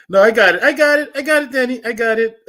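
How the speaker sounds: laughing it off loudly; laughing protest. Real, not acted. Teasing viewers, loud laughing protest.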